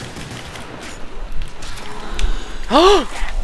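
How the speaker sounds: a big airy gasp of sudden remembering; sharp gasp. Real, not acted. Suddenly remembering his story, sharp airy gasp. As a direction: gasp in with a high breath.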